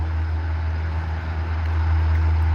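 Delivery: long airy wheeze of a laugh; low breathy chuckle. Real, not acted. Remembering the call, long breathy laugh. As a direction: laugh quietly to yourself.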